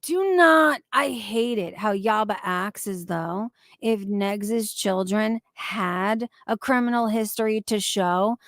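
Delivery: ordinary delivery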